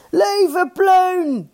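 high, long-held yell; shouted cheer. A narrator's reading. First team interrupts to cheer the chairman, shouting high. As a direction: cheer him with stretched vowels. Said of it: "schreeuwde het eerste elftal weer".

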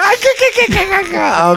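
high chattering dolphin cackle; dolphin-like clicking laugh. Pretend. Answers the Flipper joke.

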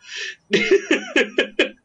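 squeaky high laughter; high helpless giggle. Real, not acted. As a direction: giggle uncontrollably. Laughing after recalled thought.

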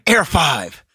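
actor rehearsing his catchphrase in earnest; Rob Maschio. Retold or imitated. Actor running his one line over and over, mock-serious. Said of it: "running his one line".

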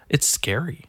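ordinary talk, nothing marked

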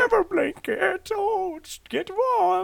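high, slow, drawn-out maid voice; hammed-up falsetto caricature of a maid; mock doting maid. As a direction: stretch it out like a cooing maid. Echoes the maid voice.

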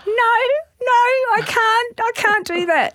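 very high, hammed-up wail of overwhelm; imitated meltdown, high and pleading; mock tearful wail. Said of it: "And I burst into tears".

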